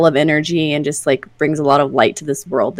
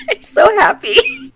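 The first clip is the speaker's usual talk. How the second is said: overjoyed. Real, not acted. Overjoyed at finding old stuff.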